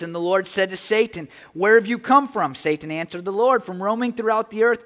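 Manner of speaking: a preacher reading Job aloud; scripture reading. Acted. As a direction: proclaim the verses to the congregation.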